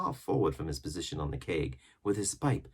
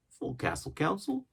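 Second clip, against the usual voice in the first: much higher sailor voice; Morgan; 11 semitones higher than usual. A narrator's reading. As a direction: announce it on leaving. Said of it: "said Morgan".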